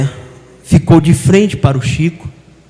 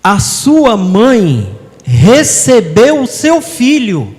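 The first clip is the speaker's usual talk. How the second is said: slow, raised spiritual elder voice; slow, lifted consolation; 7 semitones higher, 3 dB louder, slower than usual. Retold or imitated. Chico Xavier telling a grieving mother her son is safe. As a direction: comfort her slowly, lifting your voice.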